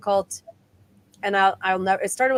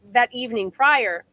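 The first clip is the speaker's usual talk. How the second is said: ordinary talk, nothing marked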